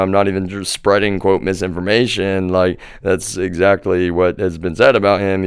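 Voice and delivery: ordinary talk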